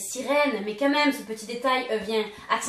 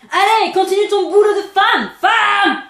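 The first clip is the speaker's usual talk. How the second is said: loud macho order; bossy shout; 7 semitones higher, 10 dB louder than usual. Retold or imitated. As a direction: bark it like a bossy boss. Impersonates Peter ordering Wendy. (ordre macho crié)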